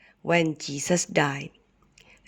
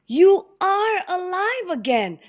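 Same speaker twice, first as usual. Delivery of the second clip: joyful amazement; 13 semitones higher than usual. Acted. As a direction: marvel that he lives. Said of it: "exclaimed the helpers".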